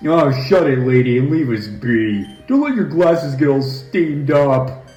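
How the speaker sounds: sneering, mocking goon; rude thug voice. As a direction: brush her off like a cocky thug. A rude thug character talks back.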